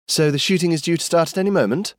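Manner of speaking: high, quick mock alarm. A narrator's reading. Girl mocks her cousin's warnings with quick teasing. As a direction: tease him about his fears. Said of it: "Skye mocked".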